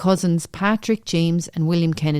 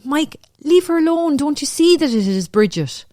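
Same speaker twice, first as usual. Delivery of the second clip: high-pitched, urgent narrated plea; pleading to reason; 8 semitones higher than usual. A narrator's reading. Cousin trying to reason with an enraged husband, pleading. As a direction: plead with him, pitch jumping up at the start. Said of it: "Joanna tried to reason with him".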